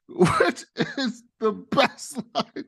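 high laughing slow praise. Real, not acted. Host laughing while praising the line. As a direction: talk through real laughter.

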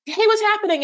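high, breezy greeting; carefree friend. Retold or imitated. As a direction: toss it off lightly. Imitating Chantel's carefree greeting.

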